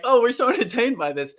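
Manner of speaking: mock the audience pretending delight; mock listeners. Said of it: "I know people are saying".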